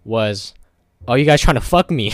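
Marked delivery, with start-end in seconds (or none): 1.1-2.1 retold angry yell, louder and higher; mimicking the red-faced boss yelling. Employee retells his angry boss shouting at him. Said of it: "I forgot exactly what he said".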